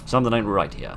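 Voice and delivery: ordinary talk, nothing marked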